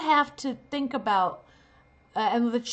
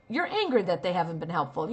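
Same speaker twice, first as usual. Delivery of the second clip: usual speaking voice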